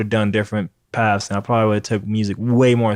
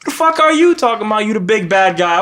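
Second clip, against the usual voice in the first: incredulous outrage; 9 semitones higher, 3 dB louder than usual. Retold or imitated. Indignant retort to villain.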